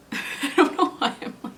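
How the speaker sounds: giggling self-consciously; embarrassed laugh. Real, not acted. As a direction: laugh it off awkwardly. Embarrassed laugh mid-sentence.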